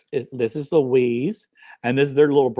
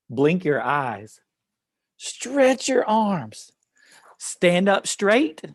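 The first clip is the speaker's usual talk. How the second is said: put-on poem read to children; 3 semitones higher, much slower than usual. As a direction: lead children through each action, slowly. Reads action poem to children.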